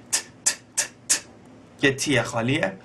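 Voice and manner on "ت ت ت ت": "ت" as short unvoiced closed hi-hat ticks. Shown as a demonstration. Teacher showing the closed hi-hat, whispered ticks. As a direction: tight dry "ت" ticks, no voice. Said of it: "همون های هت معمولیه که تمرین کردیم".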